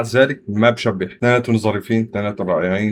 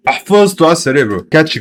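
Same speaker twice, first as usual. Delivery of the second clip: raised, excited burst; higher, louder excited remark; 6 semitones higher, 5 dB louder than usual. Real, not acted. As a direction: blurt it out with delight. Excited reaction to chorus.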